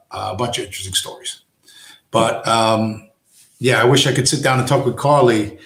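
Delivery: ordinary talk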